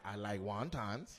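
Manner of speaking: low, slow imitation; mock woman's reply. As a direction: drop low and drag it out. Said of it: "She was like".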